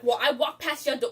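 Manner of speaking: ordinary delivery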